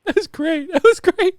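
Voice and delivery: high, loud, cracking up; real laughing. Real, not acted. Host reacting to a listener's voicemail, laughing hard. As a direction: squeal it through helpless laughter. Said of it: "I'm not laughing at him at all".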